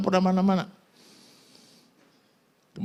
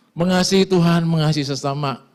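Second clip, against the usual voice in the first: preaching loudly; 7 semitones higher, 7 dB louder than usual. Acted. Preaching loud points.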